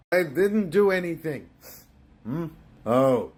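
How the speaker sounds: usual speaking voice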